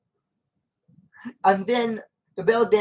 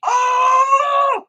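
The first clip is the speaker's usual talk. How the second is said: a high, loud gasped "Oh!"; sudden realization; 8 dB louder than usual. Retold or imitated. Coraline's brain grasping the plan, loud high startled oh. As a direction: yelp as the whole picture clicks. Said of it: "the brain's going".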